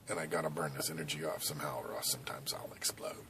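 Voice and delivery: fast, low whisper; whispered buildup. Real, not acted. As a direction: hush it before the blast. Lead-in to explosion joke.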